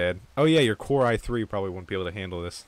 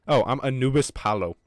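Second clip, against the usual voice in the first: ordinary talk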